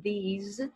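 these, final Z buzzed; these with Z sound. Shown as a demonstration. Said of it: "som de Z aqui". (these dito com som de Z no final)

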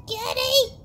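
high, loud pet call. Real, not acted. Calling the kitty.

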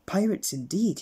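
loud, high amused scoff; smiling. Acted. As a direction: smile through it. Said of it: "he said, smiling".

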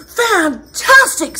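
high, loud, drawn-out praise; gushing storybook princess, stretched and high; excited cheering. Acted. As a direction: egg the dragon on with big admiration. Elizabeth cheers the dragon on.

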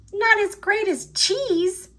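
scoffing. Acted. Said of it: "scoffed Squeak".

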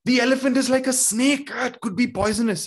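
high and loud, alarmed cry about a snake; frightened blind man shouting a warning. Acted. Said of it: "he cried out".

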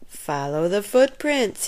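usual speaking voice

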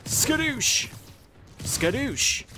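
high-pitched silly skadoosh; playful catchphrase. Pretend. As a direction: squeak out a playful battle catchphrase. Playful catchphrase during game attack.

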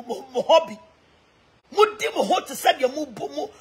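ordinary talk

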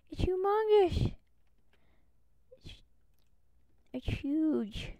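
high, breathless whisper of awe; awed whisper. Acted. Fan describing Stampy's house in an awed whisper. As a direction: hush it like a secret wonder.

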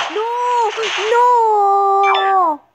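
high, loud cry of dismay; dismayed shout. Real, not acted. Gamer shouting as the round is lost. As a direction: yell it as you lose.

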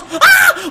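high, loud "ah" scream; excited scream. Real, not acted. Gamer watching Todd run, excited scream. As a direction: let out a thrilled shriek. Runner breaking free.

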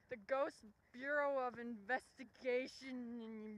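very slow, spelled-out announcer style; grand slow title. Pretend. Naming the ghost agency, very slow mock grandeur. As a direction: say it like an official name.

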